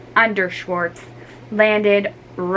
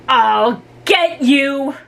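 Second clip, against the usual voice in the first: louder, slow angry yell; 5 semitones higher, 5 dB louder, much slower than usual. Acted. Ricky yells back at sisters, angry. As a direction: threaten them at full voice. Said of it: "Ricky yelled back".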